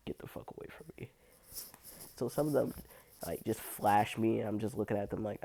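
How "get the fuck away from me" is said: faster, softer whispered brush-off. Retold or imitated. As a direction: rattle it off in a hushed whisper. Repeated rejection, faster.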